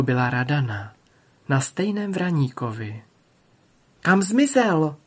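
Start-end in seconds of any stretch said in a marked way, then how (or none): 4.0-5.0 higher-pitched questioning call; narrated princess calling out. A narrator's reading. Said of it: "volala ta druhá".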